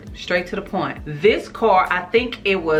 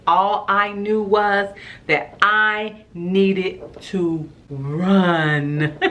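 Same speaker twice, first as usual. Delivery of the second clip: ordinary talk, nothing marked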